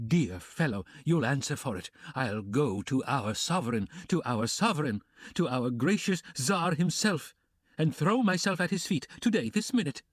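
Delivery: high, rising frantic threats; a desperate woman defying the room, pitch climbing; Katerina in a frenzy. Acted. Katerina defying Luzhin, frantic and high.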